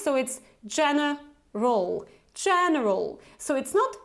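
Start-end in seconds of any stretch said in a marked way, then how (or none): 0.7-3.2 "general" with two quick schwas; model word. Shown as a demonstration. Teacher reading the word for learners to repeat. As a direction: say "general" with both middle and end vowels reduced. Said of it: "you have this schwa sound twice".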